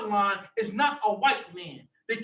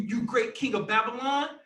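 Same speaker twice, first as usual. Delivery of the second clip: ordinary talk, nothing marked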